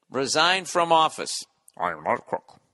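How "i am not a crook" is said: low, soft mock of the famous denial; Richard Nixon. Nixon denying it on TV, a low, soft mock. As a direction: drop low and quiet, a lightly done Nixon.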